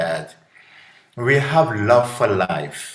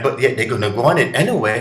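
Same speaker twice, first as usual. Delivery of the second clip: ordinary delivery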